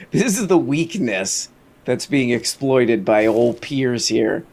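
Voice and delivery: usual speaking voice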